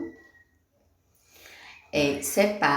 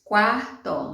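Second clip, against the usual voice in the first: "Quarto" in two beats, QUAR-TO, louder and higher; teacher's loud, drawn-out "QUAR-to"; split into syllables; 5 semitones higher, 5 dB louder than usual. Shown as a demonstration. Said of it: "Tem dois pedacinhos".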